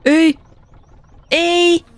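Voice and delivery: high, loud cry of dismay; sudden loud protest; shouted no. Real, not acted. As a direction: yelp it out in alarm. Dismay at game event.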